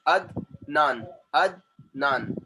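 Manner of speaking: "Adnan" as Ad, pause, nan; slow "Adnan", broken into two separate beats; split into syllables. Shown as a demonstration. Teacher giving the next example, "Adnan" split Ad - nan. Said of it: "ad one syllable".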